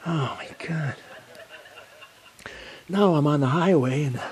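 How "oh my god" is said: breathy whispered oh my God; a whisper of dread; whispered dismay. Real, not acted. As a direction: breathe it out in disbelief. Dismay at dispatcher's news.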